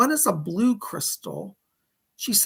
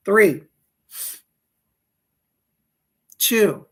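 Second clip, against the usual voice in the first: loud countdown; 3 semitones higher, 9 dB louder than usual. Acted. Counting down the meditation.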